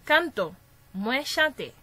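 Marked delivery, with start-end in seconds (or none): 0.1-0.5 "canto" stressed on the first syllable, "can"; stress on penultimate syllable. Shown as a demonstration. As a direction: hit "can", let "to" fall in "canto". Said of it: "Avan dènye silab la". (aksan sou "can" nan "canto")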